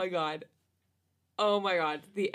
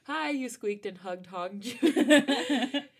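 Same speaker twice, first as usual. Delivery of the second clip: slow; 3 semitones higher, 6 dB louder, much slower than usual. Shown as a demonstration. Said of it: "why was that so hard to say?".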